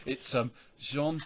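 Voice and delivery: mock hesitant attempt at a name; slow, halting, unsure; nervous pronouncing. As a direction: imitate someone fumbling nervously for the French. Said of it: "nervous of pronouncing anything".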